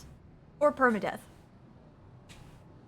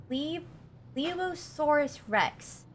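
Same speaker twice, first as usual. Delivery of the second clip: careful pronunciation; 5 semitones higher, 4 dB quieter, much slower than usual. Real, not acted. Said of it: "ooh, how do I say that?".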